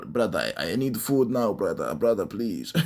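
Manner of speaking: low, strained pleading voice; soft, deep begging, weak from hunger; Islam. Acted. Islam begs his brother for food during Ramadan, low pleading. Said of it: "he's going through Ramadan right now".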